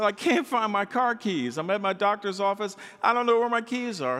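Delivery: panicked woman who lost her keys; imitating a frantic caller who lost her car keys; hysterical caller. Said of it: "She was hysterical".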